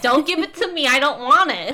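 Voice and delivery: high, loud mock refusal. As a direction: push it away, hammed-up. Venting about pushy customers.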